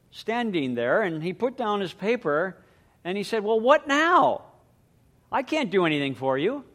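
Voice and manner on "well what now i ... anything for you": exasperated dad. Retold or imitated. Irritated dad in story.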